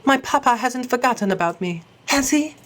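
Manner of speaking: put-on high, small girlish voice; a worried little lady, pitched high; high childlike plea; young girl voice. Voicing the young cat lady.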